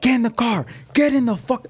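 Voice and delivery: high mock yell at him; imitating friends yelling from the car; retold scream. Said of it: "They're screaming at me".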